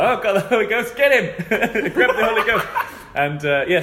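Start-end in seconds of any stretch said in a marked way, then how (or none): 0.0-2.7 high, louder laughing call; hammed-up party game glee; mock excited. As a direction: shout it like a playground game, laughing.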